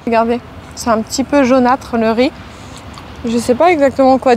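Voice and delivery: talking while chewing rice; muffled by food in mouth; mouth full, chewing. Real, not acted. Seen 3: Bites just before; jaw works and mouth looks full while speaking.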